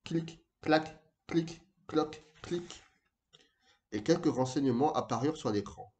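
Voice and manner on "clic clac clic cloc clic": high, separate clicking syllables; staccato typing noises; imitated keyboard clicks. A narrator's reading. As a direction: tick out each key press like a little sound effect. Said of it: "Il tapota sur le clavier".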